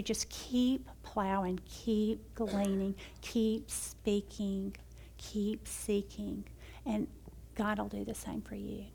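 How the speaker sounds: slow, softening, fading to a whisper; gentle encouragement. Real, not acted. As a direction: ease each line down gently. Closing exhortation to the audience.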